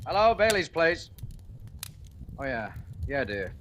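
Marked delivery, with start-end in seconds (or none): 0.1-1.1 phone answer. Acted.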